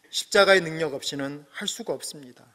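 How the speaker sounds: ordinary delivery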